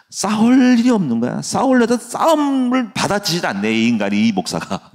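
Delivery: high, excited mock grumble; lightly voiced frustrated rival; mock frustrated opponent.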